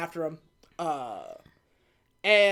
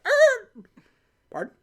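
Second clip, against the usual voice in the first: much higher, louder startled "Er" with a laugh; mock surprise; 13 semitones higher, 7 dB louder, slower than usual. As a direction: play up the disbelief. Jay's shocked reply, retold.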